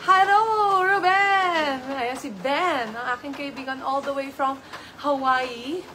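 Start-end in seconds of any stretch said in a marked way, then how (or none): 0.1-1.8 excited greeting. Real, not acted. Calling out greeting to friend.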